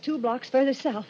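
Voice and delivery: ordinary talk, nothing marked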